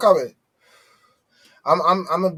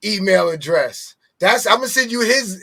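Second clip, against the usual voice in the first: normal talking voice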